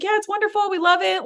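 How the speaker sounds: high, quick-to-please imitation of a keen host; retold enthusiastic welcome. Network owner welcoming a new podcast host, imitated enthusiasm.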